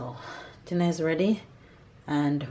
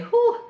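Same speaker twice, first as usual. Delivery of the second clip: high, loud puff of breath; out of breath after a run; breathless whew; 6 dB louder than usual. Real, not acted. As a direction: blow out hard after the workout. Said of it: "I'm out of breath".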